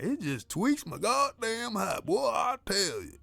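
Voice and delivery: high, slow, goofy cable guy. Shown as a demonstration. Showing listeners the voice coworkers hear, goofy cable guy. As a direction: draw it out in a silly high-pitched twang. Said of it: "my cable guy voice".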